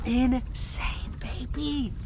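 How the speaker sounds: soft whispered delight; awed whisper. Real, not acted. Diver admiring his fish, whispered. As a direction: murmur it to yourself.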